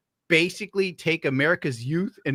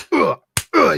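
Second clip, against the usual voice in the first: high, loud grunts as if being punched; mock grunts of pain; 11 semitones higher, 8 dB louder than usual. Streamer acting out life hitting you, loud mock grunts. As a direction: grunt like you're taking blows.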